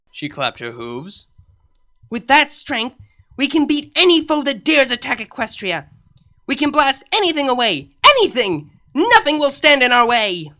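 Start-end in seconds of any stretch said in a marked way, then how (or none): 2.1-10.5 rising to a loud, fervent cry; triumphant. Acted. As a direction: declare it like a battle cheer. Triumphant rant about blasting foes.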